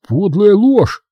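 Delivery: put-on young man shouts at the lying robber; shouting. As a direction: fling the accusation back hard. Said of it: "выкрикнул Натаниэль".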